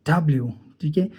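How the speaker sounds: ordinary delivery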